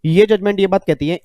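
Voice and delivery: usual speaking voice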